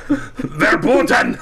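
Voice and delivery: high, drawn-out mock German shout; bad German accent. Co-host re-enacts his verboten mix-up, bad German accent shout. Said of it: "say verboten in a really bad German accent".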